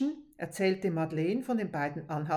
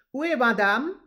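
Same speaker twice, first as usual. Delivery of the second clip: shouting; 4 semitones higher, 9 dB louder than usual. Acted. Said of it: "brüllte er durchs Haus".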